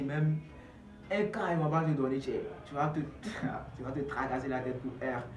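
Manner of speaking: normal talking voice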